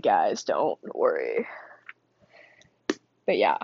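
very low, whispered mock reassurance; deep mock voice. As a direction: drop way down and whisper it.

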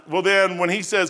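ordinary talk

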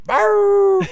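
a high, loud yap like his little dog; dog bark imitation. Imitating his dog barking at the crocodile, loud high yap. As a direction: bark it out like a small excited dog. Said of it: "my little dog Dig Dig's there just going".

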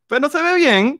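high put-on teasing voice; cartoonish mocking tone. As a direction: squeak it out to tease a friend. Teasing Santi's yellow look.

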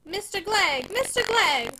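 stern wife calling loud and high from the window; severe call. Acted. Wife calling her husband in from the window, severe and loud. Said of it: "a severe voice from the open parlor window".

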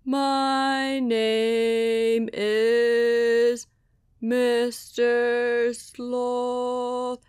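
very slow, stretched sloth voice; sleepy sloth. Acted. Sloth introduces himself to Sasha, extremely slow. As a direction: let every word crawl like a sloth.

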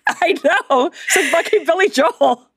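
high, laughing agreement; laughing excitedly. Retold or imitated. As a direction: laugh it out in disbelief. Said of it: "I'm like".

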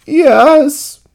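high, loud wail of admission; loud acted distress in character voice; tearful cry. A narrator's reading. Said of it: "cried Ernie".

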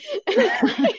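high bright laugh; sudden high laugh; burst of laughter. Real, not acted. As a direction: laugh out loud at yourself. Laughing at own story.

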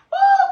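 high, loud whoop of excitement; joyful woo. Real, not acted. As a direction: let out a big preacher's whoop. Preacher's exclamation in full flow.

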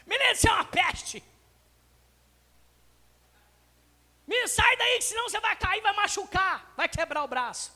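exasperated parent shouting warnings; harsh scolding parent. Acted. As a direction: yell at the kid to get down. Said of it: "eu digo".

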